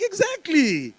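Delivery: high, loud, eager exclamation; excited yes-man squeal; gushing agreement. Retold or imitated. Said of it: "I'm like".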